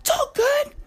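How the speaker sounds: high, humouring tone; placating the driver. Retold or imitated. Everyone in the car humouring the brother, high mock-soothing voice. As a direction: go along with him to keep the peace. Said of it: "everybody's like".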